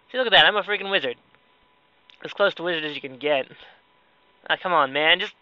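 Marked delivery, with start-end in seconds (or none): none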